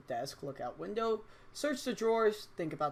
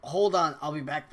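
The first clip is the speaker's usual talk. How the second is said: usual speaking voice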